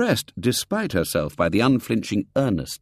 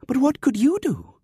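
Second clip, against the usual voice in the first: put-on wide-eyed girl. A narrator's reading. Girl questions the boy.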